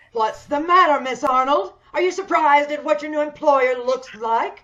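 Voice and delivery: loud, cruel mocking question; menacing loud taunt. Hideous employer taunting the frightened secretary loudly. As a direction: loom over her and mock her shock.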